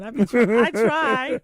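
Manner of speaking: put-on loud, laughing try at the accent. Host laughing at her own accent, loud burst. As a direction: push it out, embarrassed by her accent. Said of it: "I don't have the best accent".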